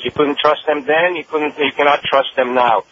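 normal talking voice

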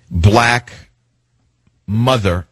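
ordinary talk, nothing marked

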